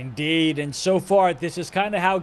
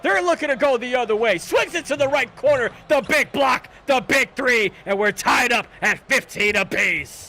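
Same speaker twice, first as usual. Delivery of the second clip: excited call; 7 semitones higher than usual. Real, not acted. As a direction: sell the big block. Big block and tying three.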